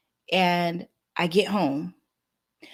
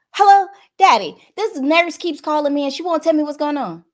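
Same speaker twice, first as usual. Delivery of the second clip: high and loud, upset and rattled; upset loud greeting; 8 semitones higher, 7 dB louder than usual. Retold or imitated. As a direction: burst out at her dad's phone, frantic. Calling her dad's phone, upset.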